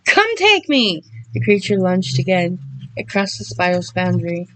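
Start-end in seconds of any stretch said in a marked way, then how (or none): 0.0-1.0 high, loud, slow challenge; desperate yelled dare; cracking yell. Acted. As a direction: yell it with the voice breaking. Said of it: "voice cracking".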